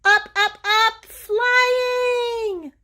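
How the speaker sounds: high, loud joyful shout; excited cheer. Acted. Boy cheering as his flying machine soars, loud and high. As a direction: cheer as it takes off.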